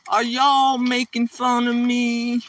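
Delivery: high, slow Southern lady; cute southern drawl. Retold or imitated. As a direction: imitate a Southern woman's drawn-out drawl. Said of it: "her real cute southern drawl".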